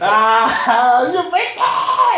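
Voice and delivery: put-on high, slow squealing party girl; mock excited girl. Imitating girls at the club, high excited squeal. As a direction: squeal it like a thrilled clubgoer.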